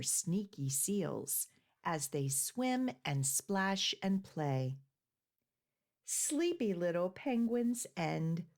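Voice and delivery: put-on soft, even rhyme reading for children; poem reading. A narrator's reading. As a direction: read the verses gently to little listeners. Reads penguin poem aloud.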